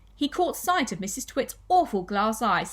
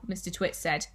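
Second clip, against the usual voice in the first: ordinary delivery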